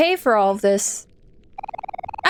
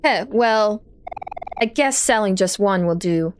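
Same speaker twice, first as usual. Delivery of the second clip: ordinary delivery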